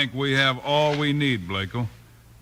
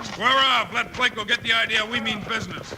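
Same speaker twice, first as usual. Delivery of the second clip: commanding order; 8 semitones higher than usual. Acted. Bill orders men before gunfight.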